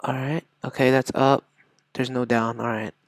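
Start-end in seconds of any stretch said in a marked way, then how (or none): none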